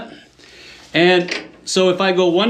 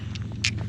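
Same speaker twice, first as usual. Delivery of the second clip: quiet little chuckle; short soft laugh before the punchline; 11 dB quieter than usual. Real, not acted. Chuckles before lost marbles gag.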